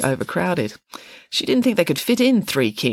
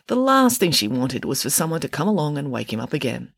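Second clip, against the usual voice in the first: ordinary delivery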